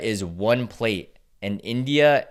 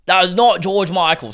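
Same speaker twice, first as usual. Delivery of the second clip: loud, high mock British accent; 9 semitones higher, 8 dB louder than usual. Repeating the fan's line from the clip, in a loud mock British accent. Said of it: "that accent was killing me".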